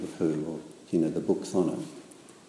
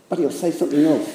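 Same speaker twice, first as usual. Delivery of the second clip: higher, louder, quick worried voice; Piglet; 8 semitones higher, 8 dB louder, faster than usual. A narrator's reading. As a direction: rush it out like anxious Piglet.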